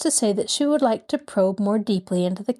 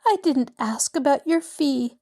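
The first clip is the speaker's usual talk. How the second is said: stammering; 7 semitones higher than usual. A narrator's reading. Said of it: "she stammered".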